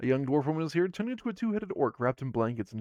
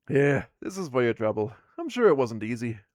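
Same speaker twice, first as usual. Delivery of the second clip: louder, gruffly thankful dwarf; grateful dwarf woman; 8 dB louder than usual. Acted. Dwarf woman rewarding players, loud gruff thanks. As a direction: hand over the reward with a grunt of thanks.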